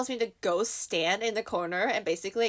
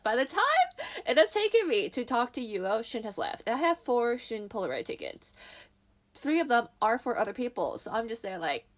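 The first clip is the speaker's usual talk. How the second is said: ordinary talk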